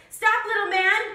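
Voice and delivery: high, loud cow call; mooing cow. Acted. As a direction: bellow it like a cow. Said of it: "mooed the cow".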